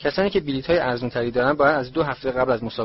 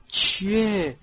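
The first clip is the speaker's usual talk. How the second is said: high-pitched innocent question; feigned innocence; 8 semitones higher, much slower than usual. A narrator's reading. Caught son answering his mother, unconvincingly innocent and high. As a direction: play innocent, voice lifted high. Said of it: "جورج با لحنی معصومانه".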